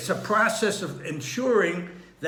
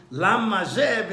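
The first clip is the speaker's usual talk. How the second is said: Lama zeh with LA stressed, higher pitched; stress on first syllable; 4 semitones higher, 4 dB louder than usual. Shown as a demonstration. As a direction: hit LA, then run on into zeh. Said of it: "it's not one of the letters".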